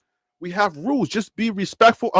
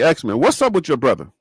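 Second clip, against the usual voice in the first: ordinary talk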